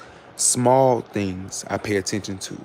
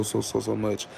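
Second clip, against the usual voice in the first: usual speaking voice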